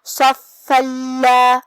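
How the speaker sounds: صف اللہ recited slowly with the doubled فا and the لام merged without nasal hum; slow recited صف اللہ with shadda; tajweed demo. Shown as a demonstration. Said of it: "ادا کریں گے".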